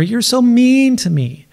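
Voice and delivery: put-on high, whining toddler voice; whiny toddler. Coach mimicking a toddler in a tantrum, high whine. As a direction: whine it like a toddler who can't get her way.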